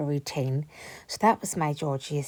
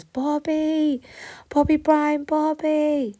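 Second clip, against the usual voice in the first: calling out; 11 semitones higher, 7 dB louder, much slower than usual. Retold or imitated. Searching the estate for the missing dog, calling loudly. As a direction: shout the name, much higher and louder, drawn out.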